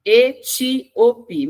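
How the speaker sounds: "Etíope" very slowly, split E-tí-o-pe; syllable by syllable. Shown as a demonstration. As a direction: break "Etíope" into separate, emphatic syllables. Teacher splits word into syllables. ("Etíope" silabado devagar, E-tí-o-pe)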